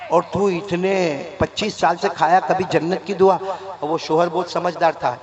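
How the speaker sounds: ordinary talk, nothing marked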